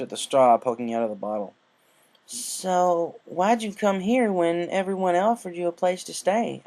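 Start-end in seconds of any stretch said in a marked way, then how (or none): 2.3-6.6 lightly higher Applejack voice; an easy country mare's question; Applejack asking. A narrator's reading. Applejack asks her guest why she came. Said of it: "Applejack asked".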